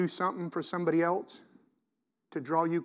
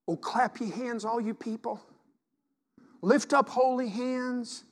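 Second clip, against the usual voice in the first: higher, slow sing-song church praise; sway into it like someone caught up in worship; mock happy worshipper; 5 semitones higher, slower than usual. Pastor imitating a happy churchgoer, slow and higher.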